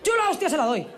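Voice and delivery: high, punchy threat in a streetwise voice. Acted. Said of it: "La ve el príncipe y dice".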